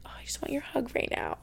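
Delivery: soft, breathy whisper, near tears; retold whispered plea. Missing her mom on FaceTime, whispering. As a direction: whisper it like you miss her badly. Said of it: "and I'm like".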